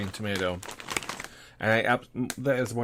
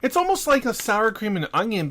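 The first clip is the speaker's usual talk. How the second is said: excited; 9 semitones higher, 5 dB louder than usual. Real, not acted. Describing flavor, voice jumps high.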